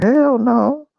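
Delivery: high, loud, mock-indignant refusal; emphatic hell no. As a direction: belt it out like it's obvious. Emphatic retold answer.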